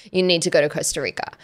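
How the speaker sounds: usual speaking voice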